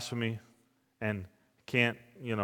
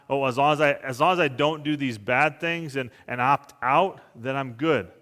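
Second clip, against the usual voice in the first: louder, higher mock of a smug excuse; mocking self-assured sinner; 3 semitones higher, 7 dB louder than usual. Preacher mocking the opt-out attitude, louder and smug. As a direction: parody the easy excuse, a bit hammed-up. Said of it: "it's not".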